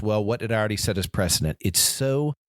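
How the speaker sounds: usual speaking voice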